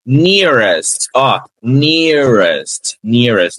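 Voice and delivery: nearest, slow and drawn out with the r held back; model English pronunciation. Shown as a demonstration. Teacher drilling students on nearest, slow and careful. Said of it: "vamos treinar aqui".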